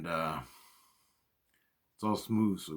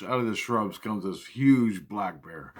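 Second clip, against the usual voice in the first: usual speaking voice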